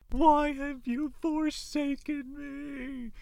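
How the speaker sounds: hammed-up tragic cry to the heavens; melodramatic anguish.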